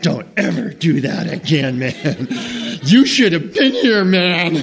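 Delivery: the dog griping about his night; rattled dog, rising to a loud yell; frazzled dog voice. Acted.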